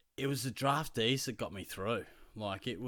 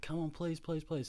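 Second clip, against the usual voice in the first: higher, pleading please please; begging kid; 7 semitones higher, 4 dB quieter than usual. Retold or imitated. Keen student begging his teacher for the walk.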